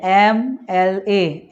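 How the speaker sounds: एम एल ए very slowly, letter by letter; slow letter demo. Shown as a demonstration. Teacher slowly sounds out एम एल ए. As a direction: pace out एम एल ए slowly. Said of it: "alphabet का sound सुनना है".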